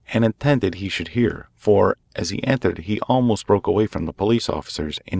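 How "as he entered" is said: plain reading voice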